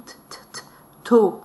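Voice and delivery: beginning reader's "t, t, t, to"; sounding out. Shown as a demonstration. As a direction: repeat the bare "t" before landing on "to". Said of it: "l'enfant lit".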